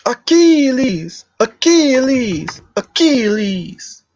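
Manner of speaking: high, long-drawn calling out of the name; Troy battle challenge. Acted. Streamer acting a scene from Troy, drawn-out high challenge call. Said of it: "Let me do Achilles from, uh, Troy".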